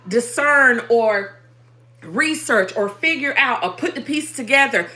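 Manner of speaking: loud, rhythmic, hammering sermon delivery; fervent preaching. Acted. Preaching to her stream audience, fervent and rhythmic. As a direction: preach it with fire to the congregation. Preaching to viewers at full force.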